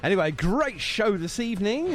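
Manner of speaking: ordinary talk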